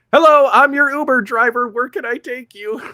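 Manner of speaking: high, loud hammed-up driver greeting; pretend Uber driver. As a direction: call out like an eager cabbie, imitating. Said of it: "he's pretending to be an Uber driver in Warzone".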